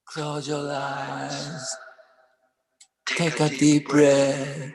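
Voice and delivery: guided meditation. Acted. Poet leads listeners through a meditation. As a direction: ease the audience into calm.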